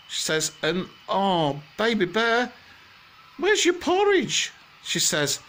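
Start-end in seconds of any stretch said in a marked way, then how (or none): 0.6-4.5 high motherly bear voice climbing higher and slower on porridge; a fussing mother bear rising to a squeak; Mummy Bear. Acted. Mother bear asks Baby Bear about porridge, high fussing voice.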